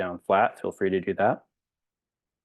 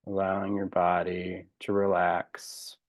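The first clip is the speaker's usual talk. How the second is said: spacious, calming breathing guidance, phrases trailing softer; guided meditation; 3 dB quieter than usual. Real, not acted. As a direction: guide them gently into relaxation, unhurried.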